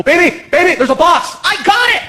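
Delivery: put-on high, over-confident girly voice; high careless girlfriend; mock girlfriend. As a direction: brush off the warning cheerfully. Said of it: "and I go".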